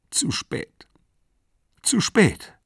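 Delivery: high, broken sobbing words. A narrator's reading. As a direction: choke the words out through tears. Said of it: "schluchzte sie".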